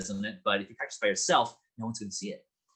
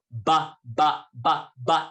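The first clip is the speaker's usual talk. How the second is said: forward lip placement; 6 dB louder, much slower than usual. Shown as a demonstration. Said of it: "we're gonna do the lips".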